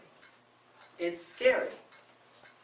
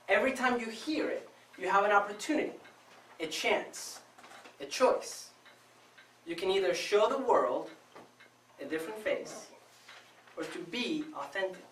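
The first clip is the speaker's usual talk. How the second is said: ordinary talk, nothing marked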